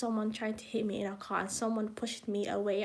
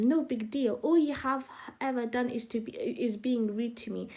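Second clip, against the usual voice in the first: ordinary talk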